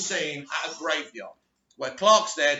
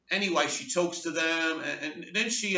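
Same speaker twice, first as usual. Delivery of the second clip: usual speaking voice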